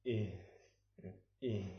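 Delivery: usual speaking voice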